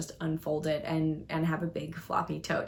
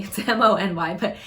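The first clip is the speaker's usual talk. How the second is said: louder, amused through a laugh; chuckling at your own wordplay; 5 dB louder than usual. Real, not acted. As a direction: laugh while making the little joke. Laughs at her own name joke.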